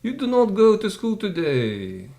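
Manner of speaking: high-pitched, sing-out parent voice; parents announcing Sunday. Acted. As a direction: stretch it out high, like a parent calling to a child. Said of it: "your mommy and father say".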